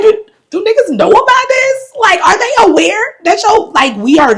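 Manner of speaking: loud, high-pitched disbelief; incredulous outburst. Retold or imitated. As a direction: blurt it out way up high, astonished. Incredulous reaction at being sunburned.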